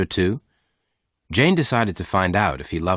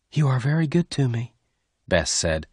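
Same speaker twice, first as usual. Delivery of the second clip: lightly voiced heroine; tender young woman; 5 semitones higher than usual. A narrator's reading. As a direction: confess your love gently. Bess thanks and confesses love.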